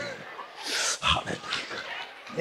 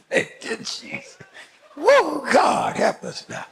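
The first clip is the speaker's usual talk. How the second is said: laughing praise; 7 semitones higher than usual. Real, not acted.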